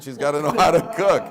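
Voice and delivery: mock-emphatic quoted wife; emphatic punchline. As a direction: land the wife's line with a push. Wife's punchline in retold story.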